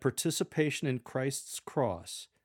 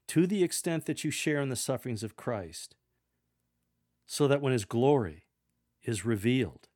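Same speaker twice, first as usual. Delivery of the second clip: scripture reading; 4 dB louder than usual. Acted. Reads scripture passage aloud.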